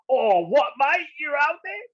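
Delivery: put-on high, loud, incredulous Australian accent. Aussie amazed at surfing in the snow, loud Australian accent. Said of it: "I'll do my Australian accent".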